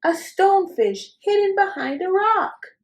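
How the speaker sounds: put-on spring the surprise on the kids; dramatic reveal.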